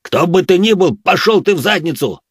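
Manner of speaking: yelling at the sky. Acted. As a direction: scream it up at the ceiling. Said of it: "проорал".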